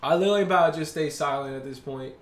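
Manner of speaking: usual speaking voice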